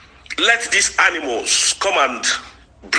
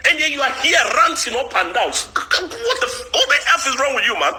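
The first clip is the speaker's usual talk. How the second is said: exasperated rant; 7 semitones higher than usual. Real, not acted. Ranting at a rival woman, exasperated and incredulous. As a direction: pitch leaps up in disbelief, then fast.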